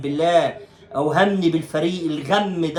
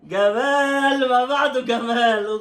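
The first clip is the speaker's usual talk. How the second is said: higher, slow, drawn-out mock admiration; sarcastic praise; 7 semitones higher, much slower than usual. Real, not acted. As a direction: stretch it out like fake applause. Sarcastic praise of bad match. (مديح ساخر ممطوط)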